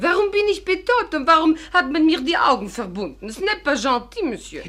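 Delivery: high, loud, outraged questions; indignant protest. Acted. Kidnapped woman protests to her captor, high and indignant. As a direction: demand answers from the captor.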